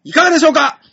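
hammed-up rallying cry; playful shout. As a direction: call it out with mock bravado. Playful shouting about sponsors.

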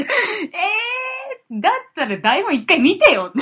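mock-offended whine; indignant retort. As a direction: protest like a wronged actor. Retorts to criticism. (不満げに言い返す)

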